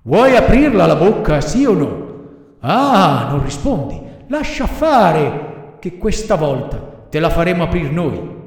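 high, swooping villain voice; threatening villain. A narrator's reading. Assassins order Pinocchio to open his mouth, taunting menace. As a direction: taunt and threaten, dragging out the words.

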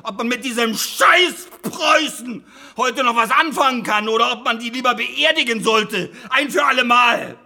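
high, loud furious rant; angry shout. Acted. Lawyer ranting at historians about Prussia, furious shout. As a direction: yell it out, fed up.

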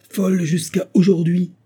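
high, loud outburst; impassioned cry. A narrator's reading. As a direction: cry out in protest. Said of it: "s'écria-t-elle".

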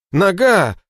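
high, loud cry of pain; pained wail. Acted. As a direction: wail about the leg. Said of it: "продолжал стонать Борщок".

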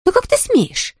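high, outraged gasp of a question; indignant. Acted. Blonde to the cop daughter, high and indignant. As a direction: bristle with offence. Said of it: "возмутилась Сэнди".

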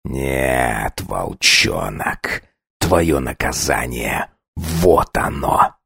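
low, slow icy threat; deep predatory menace; cold vampire menace. Acted. Vampire count punishing werewolf.

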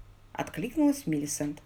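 ordinary delivery, nothing marked

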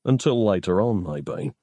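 light quip, dropping away; quipped. A narrator's reading. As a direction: quip it, letting the last word sink. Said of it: "Nick quipped".

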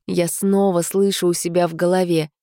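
ordinary delivery

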